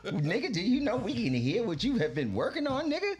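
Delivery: incredulous, high-pitched scolding through laughter; laughing mock scolding. Manager ribbing artists who act like stars, laughing mock scolding. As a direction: tease with mock outrage, breaking into laughter.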